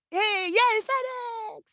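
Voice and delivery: high, loud shout of delight; excited squeal. Real, not acted. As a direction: cheer the delivery at the top of your voice. Delivery of new phone arrives.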